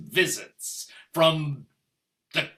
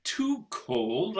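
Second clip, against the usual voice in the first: normal talking voice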